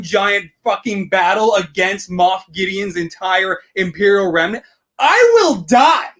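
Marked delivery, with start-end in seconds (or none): 5.0-6.1 excited. Real, not acted. Giant finale battle hype.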